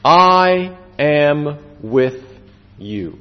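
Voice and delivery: very slow, word by word; measured, each word set apart with weight; solemn divine promise. Retold or imitated. Preacher quoting God's promise to believers, solemn and very slow. God's words quoted in a sermon.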